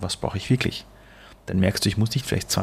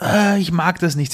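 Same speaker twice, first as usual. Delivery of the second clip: higher, slowed mock child whine; whiny child; 8 semitones higher, 3 dB louder than usual. Father imitating his screaming child, mild mock whine. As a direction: play the sulky kid refusing, lightly. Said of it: "wenn das Kind ein bisschen schreit und sagt".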